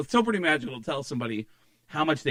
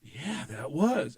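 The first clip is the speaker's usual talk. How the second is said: awed confirmation; 7 dB quieter, much slower than usual. Retold or imitated. Acted awed fan confirming the date.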